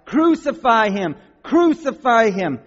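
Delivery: loud, high, drawn-out mob cry; forceful high-pitched demand. Acted. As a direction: shout it slowly like a baying crowd. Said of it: "they cried out".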